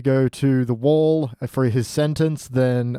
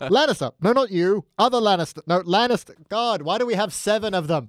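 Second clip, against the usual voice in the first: put-on higher-pitched calling out to the kids; exasperated parent; 6 semitones higher than usual. Parent summoning seven children all named Lannister, exasperated. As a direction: call for a child and get increasingly fed up.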